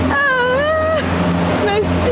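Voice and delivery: choked up, laughing through tears; overwhelmed, near tears, thanking; emotional crying laugh. Real, not acted. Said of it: "Je veux pleurer".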